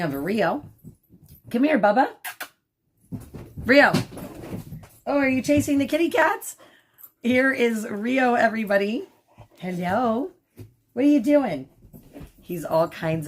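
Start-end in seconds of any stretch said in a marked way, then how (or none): 1.5-11.7 high, sing-song pet talk; talking to the dog. Real, not acted. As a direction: coo up high at the puppy. Talking to the puppy Rio.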